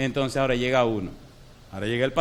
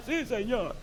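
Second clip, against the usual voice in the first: high, soft broken yes; faint high meek assent; meek whispered yes; 8 semitones higher, 6 dB quieter, slower than usual. Retold or imitated. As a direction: answer weakly from a deathbed. Dying man accepts.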